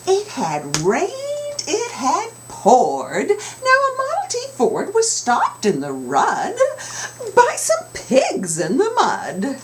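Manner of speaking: put-on lilting, expressive read to children; storybook narration, big pitch swings; read-aloud storytelling. A narrator's reading. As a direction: sing the rhyme to the kids. Reading picture book aloud.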